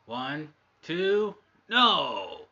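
loud rising shout on the kickout; excited. Acted. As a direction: yell the count, explode on no. Count broken by kickout.

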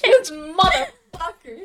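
slow, loud, high curse through laughter; real laughing indignation; laughing outrage. Real, not acted. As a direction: drag out the curse while cracking up. Outraged reaction amid laughter.

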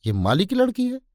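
high, doubting question; suspicious. A narrator's reading. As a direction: voice the king's disbelief, pitch leaping up on लड़की. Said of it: "संदेहशील भाव से मेरी तरफ देखकर बोले".

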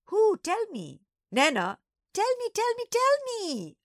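put-on very high, loud child's pestering; squeaky, excited pleading; clamouring little sister. A narrator's reading. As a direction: beg insistently, building to a drawn-out squeal.

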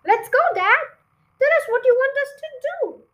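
put-on very high, loud and fast child voice; eager small fox. Small foxes urging Dad to begin, high, fast and loud. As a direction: rush Dad to start.

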